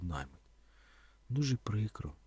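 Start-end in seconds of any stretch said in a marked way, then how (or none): 1.3-2.1 soft, low regret; sad. A narrator's reading. Donka hearing the dogs must be killed, sadly. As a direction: say it quietly, with subdued sorrow. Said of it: "сумно сказала Донка".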